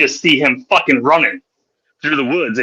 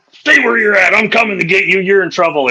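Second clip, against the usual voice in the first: mock threat; 6 semitones higher than usual. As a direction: bark the warning like an angry adult. Fisherman threatening kids.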